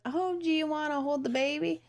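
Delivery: high-pitched imitation of an eager sister; mock sweet, high-voiced offer. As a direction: lift it high, a sing-song invitation.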